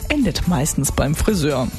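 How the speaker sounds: ordinary talk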